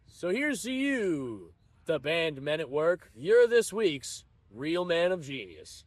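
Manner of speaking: slow, solemn beer-ad voiceover; a hint of a grand commercial announcer, slow; mock ad announcer.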